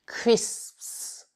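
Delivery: crisps whispered with both S sounds hissed. Shown as a demonstration. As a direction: breathe crisps out, hiss every S. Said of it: "Il faut vraiment prononcer tous les S".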